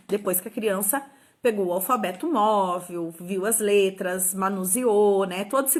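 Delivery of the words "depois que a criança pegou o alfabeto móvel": ordinary talk, nothing marked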